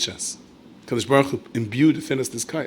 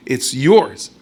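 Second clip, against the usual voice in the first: "yours" pushed louder with a rise; louder repeat of "It's yours"; louder insistence; 7 dB louder than usual. Acted.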